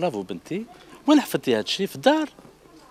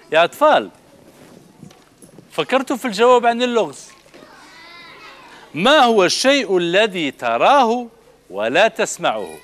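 calling out; 7 semitones higher, 4 dB louder than usual. Real, not acted. Presenter calls out a riddle.

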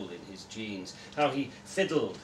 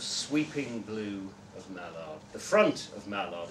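ordinary delivery

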